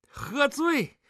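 high, incredulous scoff; derisive, high-pitched query. Acted. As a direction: toss it back as a mocking question. Tipsy son scoffs.

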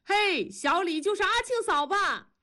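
high, excited call from the crowd; crowd heckling. A narrator's reading. Crowd casting a young woman in the opera, high jeering call. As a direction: call it out high, egging her on. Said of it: "人们起哄".

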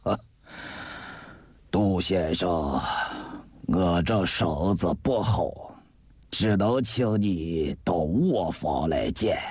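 put-on low, very slow, weary old man's voice; low, laboured welcome from a sickbed; ailing old gentleman. A narrator's reading. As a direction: draw the words out like a courteous invalid. Frail host receives his guest.

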